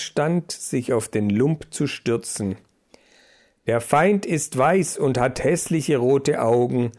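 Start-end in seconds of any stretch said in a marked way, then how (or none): none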